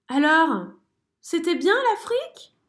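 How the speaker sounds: voix de mère curieuse, plus haute; mère souris. A narrator's reading. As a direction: questionner gentiment. Mother mouse asks. (mère souris curieuse)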